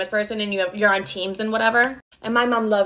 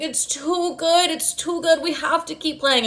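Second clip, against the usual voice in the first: giddy high mom; 7 semitones higher, slower than usual. Retold or imitated. High mom insisting on playing.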